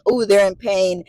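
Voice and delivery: ordinary delivery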